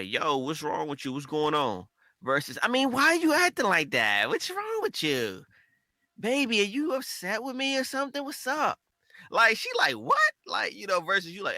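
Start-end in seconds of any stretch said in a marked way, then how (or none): 2.6-8.8 high-pitched, whiny pleading. Shown as a demonstration. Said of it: "it's all in the tone". 10.0-10.3 sharp, very high "What?"; snappy what. Retold or imitated. Girlfriend's snappy reply, imitated.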